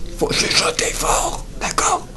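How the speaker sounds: strong whisper, no voice; forceful whisper; loud whisper. Shown as a demonstration. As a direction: whisper it so it carries.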